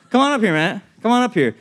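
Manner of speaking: ordinary talk, nothing marked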